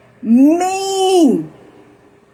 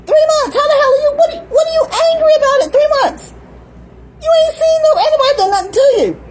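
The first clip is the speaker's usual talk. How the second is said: much higher, fast disbelieving questions; incredulous outrage; 13 semitones higher, faster than usual. Real, not acted. Outraged talking to baby.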